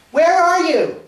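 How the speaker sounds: high, loud call from another room; high-pitched, carrying shout. Acted. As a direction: holler it across the house. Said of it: "you call out".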